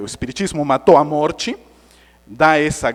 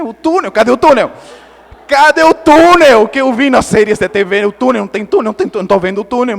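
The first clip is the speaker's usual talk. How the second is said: loud, high comic panic; hammed-up frantic demand; frantic mock yelling; 6 semitones higher, 12 dB louder, faster than usual. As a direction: shout indignantly for something that is missing. Retold frantic search for tunnel.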